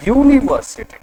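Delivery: यूनिवर्सिटी with a clear opening "yoo" sound; pronunciation demo. Shown as a demonstration. Teacher showing students how the word is read, pronunciation demo of यूनिवर्सिटी with "yoo". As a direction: sound out यूनिवर्सिटी starting on a y-glide. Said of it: "इसको अपन read करते समय क्या पढ़ते हैं".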